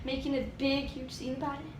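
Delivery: soft, discreet mutter; quiet aside. Retold or imitated. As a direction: slip it in quietly, under the voice. Muttered voicing of line-cutter sliding in.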